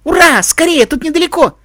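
put-on high, gleeful child's shout; excited cheer. Tim wins the argument at the fork, high excited cheer. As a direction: cheer and hurry the others along.

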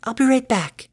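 high, gentle pony voice; lightly voiced shy mare. Fluttershy voiced in story.

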